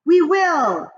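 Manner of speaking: high loud eager witches; witch chorus. Acted. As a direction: agree like a cackling coven. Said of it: "they cried".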